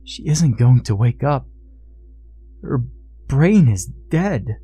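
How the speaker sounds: earnest pleading. A narrator's reading. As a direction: reason with him imploringly, pausing between phrases. Father pleading with son-in-law.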